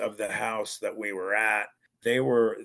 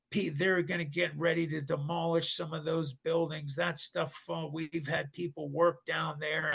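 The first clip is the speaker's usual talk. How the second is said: higher-pitched imitation of a ranting father; ballistic dad; 7 semitones higher than usual. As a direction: rant at the kids in retold outrage. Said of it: "and he went ballistic".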